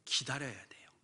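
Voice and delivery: sudden soft whisper. Acted. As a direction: drop to a secret whisper. 외침 뒤 갑자기 속삭임. (속삭이듯)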